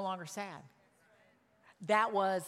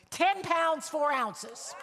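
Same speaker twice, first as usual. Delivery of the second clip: higher and louder, thrilled; excited exclaim; 7 semitones higher, 4 dB louder, much slower than usual. Real, not acted. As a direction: announce the weight with delight. Announcing the huge birth weight.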